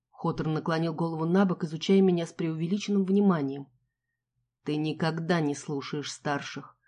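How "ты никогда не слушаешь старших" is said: low, slow and soft, squeezed through teeth; through gritted teeth. Acted. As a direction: grind out a quiet threat. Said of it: "процедил он сквозь зубы".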